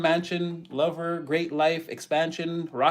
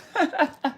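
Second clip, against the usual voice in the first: high, loud burst of laughter; real high-pitched cackling laugh; 13 semitones higher, 4 dB louder than usual. Real, not acted. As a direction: crack up at your own joke. Laughs at own joke.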